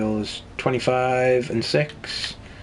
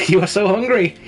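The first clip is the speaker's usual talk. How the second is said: playful raised teasing voice; teasing sing-song; 8 semitones higher, 5 dB louder than usual. Real, not acted. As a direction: tease the greedy hero fondly. Teasing his hungry character.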